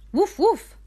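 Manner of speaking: high, loud playful woof; puppy barks. Acted. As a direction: yip like a little puppy. Mom voices the puppy.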